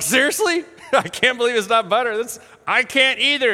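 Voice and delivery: retold laughing astonishment; amused disbelief. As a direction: react as if you can't believe it, amused. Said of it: "To which I died laughing. I was like".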